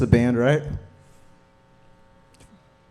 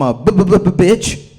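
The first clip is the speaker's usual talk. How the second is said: fake stutter; 5 semitones higher, 5 dB louder than usual. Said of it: "pretend I have a stutter".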